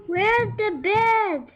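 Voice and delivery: high, slow piggy question. Acted. Lazy Pig asking the robot pig, high and slow. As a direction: squeak it out like a lazy little pig. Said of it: "asked Lazy Pig".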